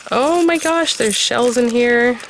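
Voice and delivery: high, drawn-out gush of delight; delighted surprise. Real, not acted. Crafter discovers shells in the kit, delighted surprise. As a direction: gush in real delight, pitched well up.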